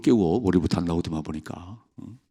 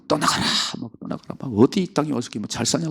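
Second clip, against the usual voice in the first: jealous king; 6 semitones higher than usual. Retold or imitated. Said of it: "막 시기 나가".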